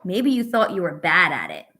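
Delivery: usual speaking voice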